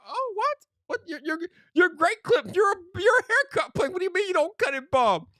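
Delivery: high, disbelieving protest; mock outraged customer; incredulous. Customer disbelieving the haircut place, high incredulous. Retold outrage at no bald cut.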